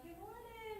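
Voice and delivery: mock chipper good morning; cheery greeting. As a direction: greet him as a happy morning person. Cheerful wife greets husband.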